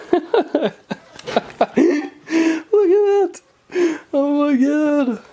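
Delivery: high-pitched laughing squeal, drawn out; giddy delight. Real, not acted. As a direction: squeal in real amazement at a new toy. Giddy at his glowing car.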